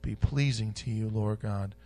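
soft, low closing prayer. Real, not acted. Pastor closing a prayer to God, soft and low. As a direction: pray it quietly to the Lord.

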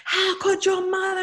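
put-on teacher screaming at a five-year-old in front of the class. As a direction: shriek it in disbelief at a child. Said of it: "just screaming".

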